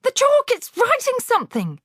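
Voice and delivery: put-on very high shrill child's scream; hysterical scream. A narrator's reading. Pupil screaming at the moving chalk, shrill. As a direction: shriek in terror, pointing. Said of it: "screamed Nigel".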